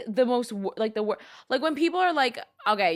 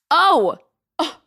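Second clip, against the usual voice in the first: sudden realization; 9 semitones higher, 12 dB louder than usual. Real, not acted. Suddenly remembers something to share.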